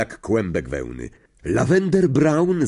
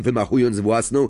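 plain reading voice

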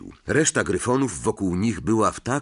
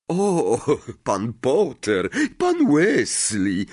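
higher, drawn-out flustered greeting; flustered stammer; 6 semitones higher, 4 dB louder, much slower than usual. A narrator's reading. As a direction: stretch the names, caught off guard. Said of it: "wybąkał".

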